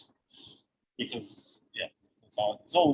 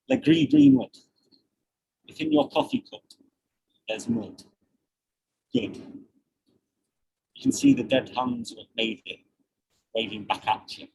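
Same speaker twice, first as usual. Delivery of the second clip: poem reading; 3 semitones lower than usual. Acted. Rereads the same poem.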